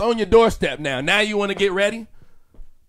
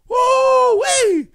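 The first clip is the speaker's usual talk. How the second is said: high whoop; 6 dB louder than usual. Real, not acted. Exclamation after rant.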